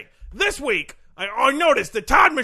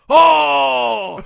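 loud mock cry; 9 dB louder than usual. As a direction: wail at your own zinger. Loud mock cry after own joke.

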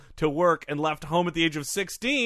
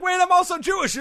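mock Dempsey; 12 semitones higher, 3 dB louder than usual. Voicing Dempsey picking fights.

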